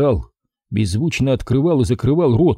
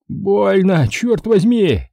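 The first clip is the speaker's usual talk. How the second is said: yelling in pain; 8 semitones higher, much slower than usual. Acted. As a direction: shout it through the pain. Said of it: "вновь заорал мужик".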